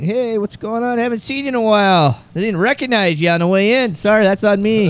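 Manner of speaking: fake friendly small talk. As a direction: play along as if he's an old friend. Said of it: "I'm like".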